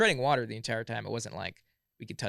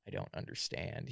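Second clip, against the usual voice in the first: quiet, breathy bewildered voice; weak and quiet; 3 semitones lower, 9 dB quieter than usual. Retold or imitated. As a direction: sound drained and confused. Sick friend on the phone.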